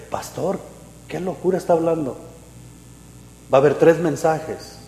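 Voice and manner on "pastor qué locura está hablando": much softer, doubtful aside; a listener murmuring doubt about the pastor; mock skeptical mutter. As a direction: grumble it under your breath.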